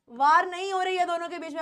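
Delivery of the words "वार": वार with an open aa, "waar"; wrong pronunciation of war. Shown as a demonstration. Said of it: "आ की sound आ रही है". (war को गलत "वार" बोलते हुए)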